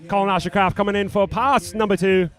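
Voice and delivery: hammed-up Aussie announcer; Australian accent. Commentator calling pass two in mock Australian accent. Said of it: "try to do our Australian accents".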